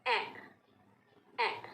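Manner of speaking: ए with the mouth opened a little; short open ए repeated; open e sound. Shown as a demonstration. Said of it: "थोड़ा सा मुंह खोल के". (मुंह थोड़ा खोलकर ए)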